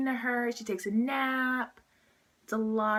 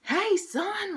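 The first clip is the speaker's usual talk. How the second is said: high, drawn-in impression of a friendly dad; hearty dad; 11 semitones higher than usual. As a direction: play the over-friendly dad greeting his son, pitched way up. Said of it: "the dad, sorry, he's really like".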